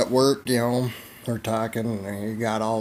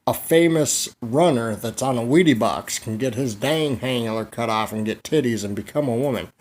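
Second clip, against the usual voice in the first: ordinary delivery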